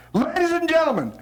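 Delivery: high, drawn-up showman introduction; announcer. Real, not acted. Host introducing the daddies to parents, announcer. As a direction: hype the act to the crowd.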